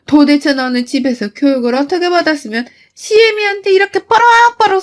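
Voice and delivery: 높고 큰 목소리로 호통치는 시어머니 흉내; 며느리를 쏘아붙이는 분노; 시어머니의 호통. Retold or imitated. (며느리에게 호통치는 시어머니)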